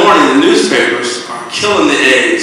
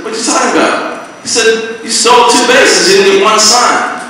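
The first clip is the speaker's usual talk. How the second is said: incredulous; 8 semitones higher than usual. Retold or imitated. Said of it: "And he says".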